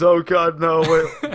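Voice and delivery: high, loud panicked cry; scream. Acted. Corvette screaming as the skeletons leave, loud high panic. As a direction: yelp in alarm. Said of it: "Corvette screams".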